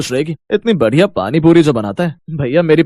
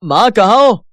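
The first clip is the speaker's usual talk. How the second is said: higher, raised-voice call; 8 semitones higher, 4 dB louder, slower than usual. Acted. Son looking for his mother, calling out. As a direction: call out for someone in the house. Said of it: "क्यों चिल्ला रहा है".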